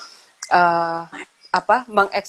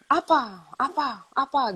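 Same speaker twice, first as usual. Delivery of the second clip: put-on older child snapping; 7 semitones higher than usual. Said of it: "Terus yang besar".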